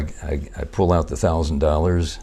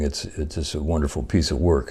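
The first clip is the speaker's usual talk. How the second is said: ordinary talk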